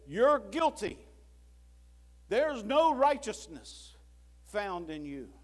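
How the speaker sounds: God as judge condemning the sinner, slow and loud; God's verdict. Acted. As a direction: pronounce it slowly like a stern judge.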